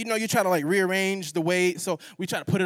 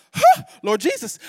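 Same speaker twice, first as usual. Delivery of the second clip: high, loud startled exclamation; shocked gasp; 11 semitones higher, 8 dB louder than usual. Retold or imitated. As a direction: blurt it out in sudden horror. Shocked at trash trail.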